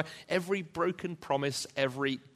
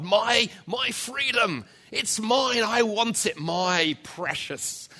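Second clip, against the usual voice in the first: high, loud, slow greedy Gollum screech; 8 semitones higher, 6 dB louder, slower than usual. Retold or imitated. Preacher voicing Gollum screaming at God for his freedom. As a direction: screech it like Gollum clutching the ring. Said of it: "rather like Gollum".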